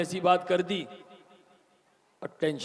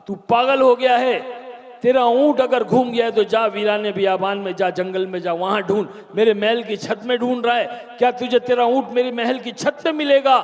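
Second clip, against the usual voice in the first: angry king; 5 semitones higher, 5 dB louder than usual. Acted. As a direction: tell the fool off. King scolds the camel man. (ऊँट वाले को डाँटता बादशाह)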